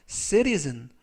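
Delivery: "citizen" with the t softened to a flap; "citizen" with the t as a light r; soft t. Shown as a demonstration. Teacher shows the soft t in "citizen", slow and careful. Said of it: "T 발음을 부드럽게 르".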